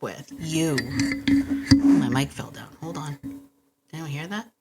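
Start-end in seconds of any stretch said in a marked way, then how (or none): none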